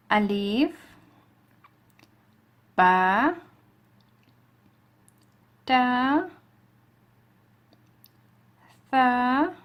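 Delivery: each letter called out slowly, loud and drawn out; clear letter recitation. Shown as a demonstration. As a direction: recite the alphabet for students to repeat. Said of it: "So you can repeat after me".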